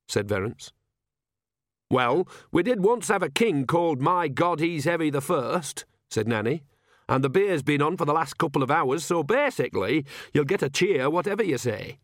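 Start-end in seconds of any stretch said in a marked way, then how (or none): 1.9-5.8 higher-voiced village woman, chatty; Nanny, reassuring. Nanny reassuring the king, higher and chatty. 7.1-12.0 higher, chatty village woman; Nanny, reassuring. Acted. Nanny reassuring the king about the crowd, higher and chatty.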